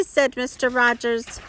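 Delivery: ordinary delivery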